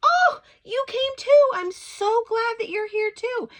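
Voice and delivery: effusive, high-pitched hello; gushing greeting. Retold or imitated. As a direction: greet her like a dear old friend. Lady turns to greet narrator.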